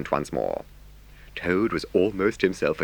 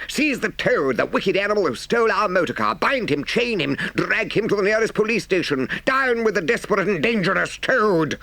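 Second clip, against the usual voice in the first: high, urgent angry cries; rally the mob to grab the villain; horrified outcry; 13 semitones higher, 3 dB louder than usual. A narrator's reading. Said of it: "they cried".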